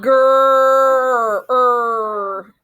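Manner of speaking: Grr then Er held and drawn out; stretched er sound. Shown as a demonstration. Teacher isolating the er sound for children. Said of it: "I hear er sound".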